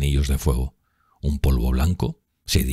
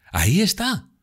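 excited exclamation. A narrator's reading. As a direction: exclaim as you spot your beloved. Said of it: "exclamó". (exclamación aguda y emocionada)

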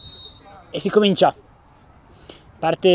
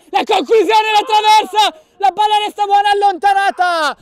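excited; 6 semitones higher than usual. Real, not acted. Near miss hits the crossbar.

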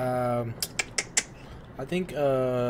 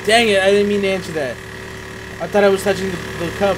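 exasperated; 7 semitones higher, 10 dB louder, faster than usual. Real, not acted. Frustrated at accidental answer.

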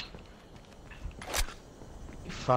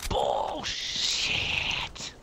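whispered; 12 semitones lower than usual. Real, not acted. Whispered reaction to being killed.

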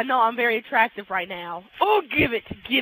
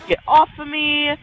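ordinary talk, nothing marked

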